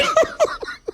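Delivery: shrill squeal of laughter trailing up; high squealing laugh. Retold or imitated. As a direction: squeal with giggles. After shrieked quote.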